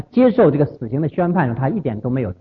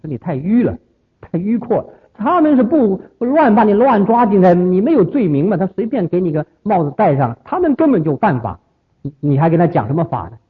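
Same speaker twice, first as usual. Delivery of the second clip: higher and louder, protesting in disbelief; exasperated protest; 5 semitones higher, 3 dB louder than usual. Retold or imitated. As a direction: scold the stubborn teacher. Disciples protesting to their teacher.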